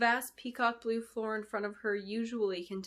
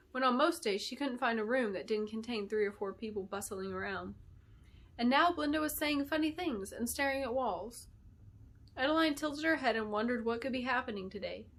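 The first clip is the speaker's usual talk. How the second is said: ordinary delivery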